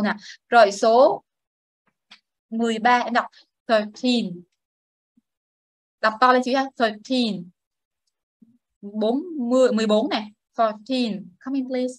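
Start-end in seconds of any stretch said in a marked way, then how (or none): 3.7-4.4 put-on thirteen with TEEN stressed and drawn out; thir-TEEN, second syllable prominent. Shown as a demonstration. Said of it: "nhấn trọng âm số hai". 6.8-7.5 put-on thirteen with TEEN stressed and drawn out; thir-TEEN, second syllable prominent. Shown as a demonstration. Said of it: "nhấn trọng âm số hai". 10.6-11.2 put-on Fourteen with TEEN stressed; four-TEEN, second syllable prominent. Shown as a demonstration. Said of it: "nhấn trọng âm số hai".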